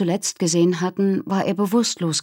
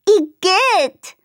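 high, loud "igitt"; disgusted; 12 semitones higher, 5 dB louder than usual. Acted. Minnie grossed out by frozen snot, disgusted. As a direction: recoil in disgust.

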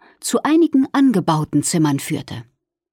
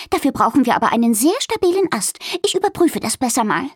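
quick, higher squirrel voice; Fiona; 7 semitones higher, faster than usual. A narrator's reading. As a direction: rattle it off like a brisk squirrel.

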